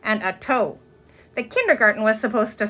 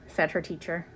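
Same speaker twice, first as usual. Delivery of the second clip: ordinary delivery, nothing marked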